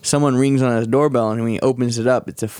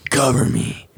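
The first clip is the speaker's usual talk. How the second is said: Gears of War voice; slower than usual. Acted. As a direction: growl it like a game marine. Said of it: "Gears of War voice time".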